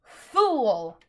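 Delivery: loud furious bark from the witch; furious shout. A narrator's reading. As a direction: snap it out like a whip. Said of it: "came her voice".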